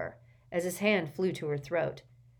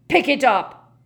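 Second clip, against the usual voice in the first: angry bellow; 7 semitones higher, 13 dB louder than usual. A narrator's reading. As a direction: bellow it, much louder and higher. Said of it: "Gaunt bellowed at her".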